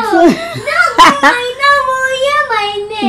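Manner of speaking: long high burst of laughter; real laughter; hearty laugh. Real, not acted. Prank revealed.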